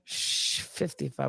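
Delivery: long shush, then soft low words; hushed shushing. Acted. Co-hosts trading an age reference, hushed. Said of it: "Why shh, fifty-five?".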